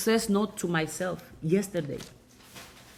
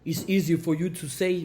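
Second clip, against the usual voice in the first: mock complainer. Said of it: "Enough saying, Oh".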